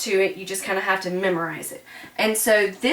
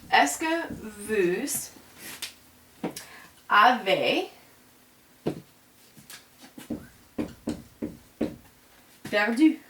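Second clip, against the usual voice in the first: very slow, syllables drawn out while writing; dictating the French as it is written out; slow dictation; 4 dB quieter, slower than usual. Shown as a demonstration. Teacher writing the question on the board for students, very slow. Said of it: "what color am I doing these in?".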